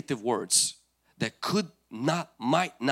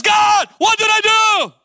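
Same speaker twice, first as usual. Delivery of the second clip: scared cry; 14 dB louder than usual. Retold or imitated. Saul cries to God afraid, loud panicked shout. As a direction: wail it up to God in hammed-up fright. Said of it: "is afraid, scared".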